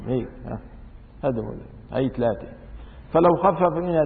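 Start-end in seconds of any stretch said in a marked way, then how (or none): none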